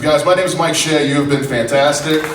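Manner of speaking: loud, high call to the crowd; stage sign-off. Real, not acted. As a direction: build into an MC's shouted hand-off. Signs off, introduces next act to crowd.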